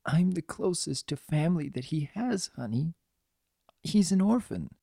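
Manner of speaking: soft, high, strained nurse; near sobbing. A narrator's reading. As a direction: confess it softly, holding back a sob. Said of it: "halfway between a helpless sob and a whine".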